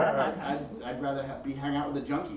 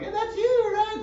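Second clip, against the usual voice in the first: squeaky high excited coworker; 4 dB louder than usual. Retold or imitated. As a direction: pitch way up, gushing. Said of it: "and like".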